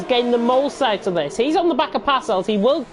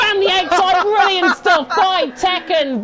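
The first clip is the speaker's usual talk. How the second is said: high, loud commentator's shout; exhilarated play-by-play climax; thrilled race call; 7 semitones higher, 4 dB louder, slower than usual. Real, not acted. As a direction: roar the pass home, stretching the praise.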